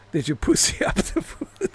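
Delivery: can't get the line out for laughing; real laughing. Real, not acted. Repeating his typo to viewers, laughing through it.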